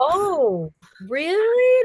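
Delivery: high, loud disbelieving "really?"; surprised. Retold or imitated. Newcomer hearing a veteran never listens back, loudly surprised. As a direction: shoot up in pitch, startled. Retold reply to a narrator.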